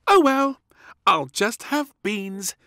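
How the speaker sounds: high, loud delight; gleeful little princess. Acted. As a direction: cheerfully get her way. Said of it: "said little Princess gleefully".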